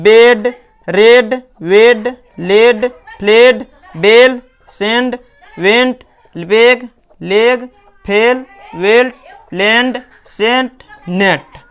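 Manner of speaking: loud, slow word list, each "e" held, as in बेड; teacher drilling words like बेड loudly with a drawn-out "e"; short-e word drill. Shown as a demonstration. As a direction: call out बेड, रेड, वेड one by one, stretching the "e". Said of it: "ई का उच्चारण ए होता है".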